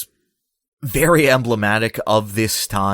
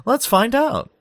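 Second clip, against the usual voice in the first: much higher talk-show host tease; Larry King. Pretend. Podcaster parodying a talk show host, high hammy tease. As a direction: toss to a commercial like a host. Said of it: "feels very Larry King that I'm doing".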